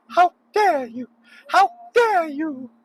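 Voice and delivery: a squeaky, drawn-out scold of pure indignation; Greta Thunberg impression. Driver mocking other drivers in a squeaky outraged falsetto. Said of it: "Greta Thunberg".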